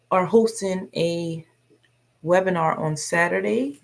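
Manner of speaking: usual speaking voice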